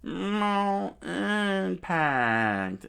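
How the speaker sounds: slow stretched loud cry; pained cry. Acted. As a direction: cry out on impact. Usopp hit, attack cry.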